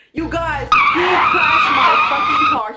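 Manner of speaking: loud, slow outraged outburst; outraged yelling. Real, not acted. Telling viewers he crashed her car, loud outrage. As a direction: yell it like the car was wrecked.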